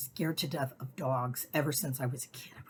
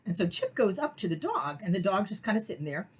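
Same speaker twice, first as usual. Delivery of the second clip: ordinary talk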